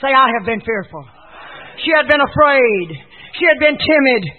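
loud, high call-and-response preaching. Real, not acted. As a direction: punch each phrase out to the congregation.

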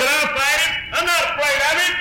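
high, firm declaration; bold young David. Acted. David declaring to his brothers he will fight, high and firm. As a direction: stand up and claim it.

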